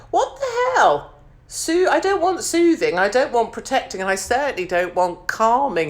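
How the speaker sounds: shocked squeal rising over the label; outraged disbelief. Real, not acted. Outraged at soothing cream label.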